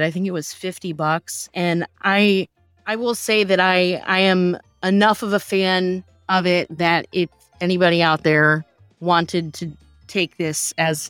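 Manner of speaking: normal talking voice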